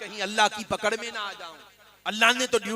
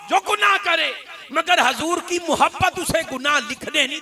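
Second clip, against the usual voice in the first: fervent preaching; 7 semitones higher, 6 dB louder than usual. Acted. Preacher stirring the congregation, fervent and loud. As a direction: pour devotion into every phrase.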